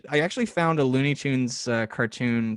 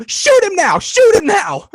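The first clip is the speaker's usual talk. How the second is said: high, loud chanting shout; mock shouting crowd; 11 dB louder than usual. Animators in the meeting shouting their vote, high excited yells. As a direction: yell it like a rowdy room of animators.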